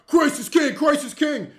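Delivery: loud, high repeated chant; mock yell. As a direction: heckle the praying crowd at full voice. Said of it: "They'll yell at Muslims".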